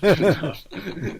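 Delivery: real warm laugh; laughter. Real, not acted. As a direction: laugh along openly. Teacher laughs along.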